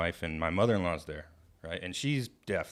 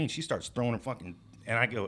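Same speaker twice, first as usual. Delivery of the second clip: ordinary talk, nothing marked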